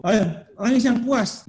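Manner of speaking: high, slow acted encouragement; egging on. Voicing himself urging child to cry.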